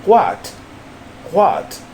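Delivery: "what" as a single isolated word, carefully sounded; careful word model. Shown as a demonstration. Teacher has learner feel the word, careful "what". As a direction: say "what" precisely, on its own with falling pitch. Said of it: "feel the words like".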